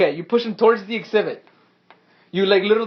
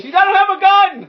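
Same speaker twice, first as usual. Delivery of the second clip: high, loud objection; loud, high-pitched protest; exasperated yell; 11 semitones higher, 7 dB louder than usual. Real, not acted. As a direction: shout back in disbelief. Game master yells back at player.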